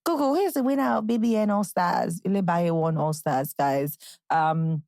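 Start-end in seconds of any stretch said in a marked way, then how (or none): 0.0-2.2 acted search query. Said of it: "asking Google".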